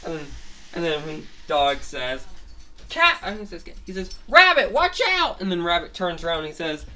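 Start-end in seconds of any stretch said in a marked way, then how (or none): 2.9-3.2 high, loud urgent call; warning shout. Acted. Dog calls to warn his friend, warning shout. 4.3-5.4 high-pitched, very loud alarm cry; warning shout. Acted. Dog warns Rabbit of the thrown tower, warning shout.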